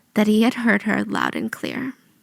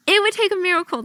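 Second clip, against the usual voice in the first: higher mock voice of doubting doctors; mocking doubters; 7 semitones higher, faster than usual. Host mocking the doctors who doubted her, higher. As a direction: parody the skeptics, pitched up. Same quote third time.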